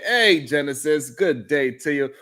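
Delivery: normal talking voice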